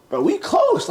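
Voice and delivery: high-pitched appeal to a friend; mock-wounded protest. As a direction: plead it high, in playful disbelief. Retold protest to trusted coach.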